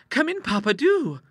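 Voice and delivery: put-on high, loud young daughter calling out; lively girlish cry of welcome, pitched high; eager cry. A narrator's reading. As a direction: call him in with bubbly eagerness. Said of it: "cried Ida".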